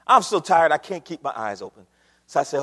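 usual speaking voice

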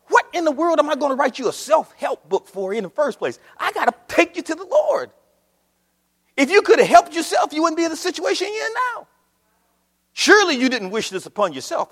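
preaching at full force. Acted. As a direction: lift the church with a rising call.

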